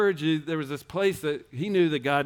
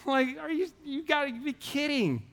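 high comic whine of disbelief; incredulous; 12 semitones higher, 3 dB quieter than usual. Retold or imitated. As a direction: still much higher but softer, a laughing mock whine. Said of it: "I was like".

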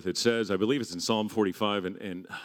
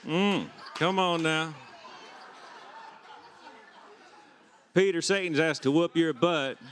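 put-on folksy Southern-accented Jesus; 4 semitones higher than usual. Jesus reassuring Peter about Satan in Southern accent. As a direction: play Jesus as a Southern good old boy. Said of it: "he's from Virginia or Arkansas".

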